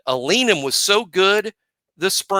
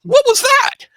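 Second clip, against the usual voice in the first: much higher, mock-shocked squeal of disbelief; baffled listener; 4 dB louder than usual. Mimicking a shocked viewer of the speech, high mock disbelief. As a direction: play the stunned audience who can't believe what they heard. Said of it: "And they're like".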